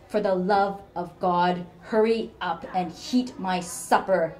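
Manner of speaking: the cruel mother tearing her daughter down; venomous rant. Acted.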